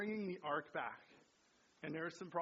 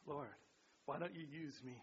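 softer, lower leading line; soft prompt; 4 semitones lower, 7 dB quieter than usual. Shown as a demonstration. Pastor quietly gives the line to repeat. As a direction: give them the line quietly. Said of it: "The last one".

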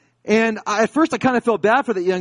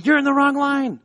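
raised, high-pitched mock scolding; laying into him; 10 semitones higher, 5 dB louder than usual. Customs agent to a student in line, high mock scolding. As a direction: imitate an agent telling someone off, pitched high. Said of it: "just really laying into him".

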